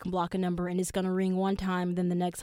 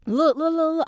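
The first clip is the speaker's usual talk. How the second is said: calling after her; 8 semitones higher, 6 dB louder than usual. Retold or imitated. Calling after crying girl on pier.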